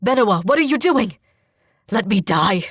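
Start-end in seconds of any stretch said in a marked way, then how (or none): 1.9-2.6 urgent, loud scolding of a sister; hissed reproach. A narrator's reading. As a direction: scold her urgently, voice raised. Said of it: "Bashir hisses".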